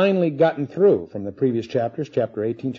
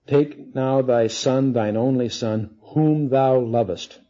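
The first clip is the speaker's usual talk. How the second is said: very slow, deliberate reading, phrase by phrase; slow and measured, letting each phrase land; slow scripture reading; slower than usual. Shown as a demonstration. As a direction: draw out every word of the verse. Slow pointed re-reading of the verse.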